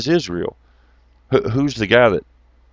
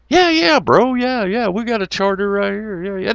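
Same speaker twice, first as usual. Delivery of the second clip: pitched way up, goofy bro; high mock bro voice; 9 semitones higher than usual. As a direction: squeak it out like a dopey bro. Mock dialogue, high put-on voice.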